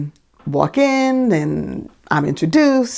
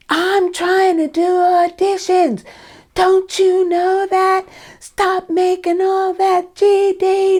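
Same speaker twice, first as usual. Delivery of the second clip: high, slow, furious mock scream of the director; retold screaming; 13 semitones higher, much slower than usual. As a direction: scream each word out slowly, way up high. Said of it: "he starts screaming at the top of his lungs".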